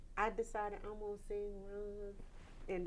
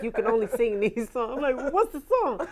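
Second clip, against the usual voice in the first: loud, higher, incredulous; mock confused; 3 semitones higher, 14 dB louder than usual. Baffled singer asking audition staff for the song. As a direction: act out the baffled question.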